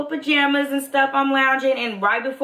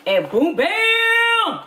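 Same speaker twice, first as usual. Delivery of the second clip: slow, high, loud reveal; dramatic boom bam; 12 semitones higher, 4 dB louder, much slower than usual. Real, not acted. To viewers while popping the box open, a slow, high, loud boom bam.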